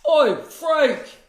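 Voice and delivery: loud, high mock British accent; cheeky British shout. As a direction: bellow it in a hammed-up British accent. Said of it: "do it with British accents".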